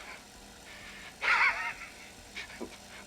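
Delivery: a high, gleeful mad-scientist cackle; evil laugh. Acted. Scientist dreaming of the crystals' power, gleeful evil cackle. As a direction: cackle with greedy delight.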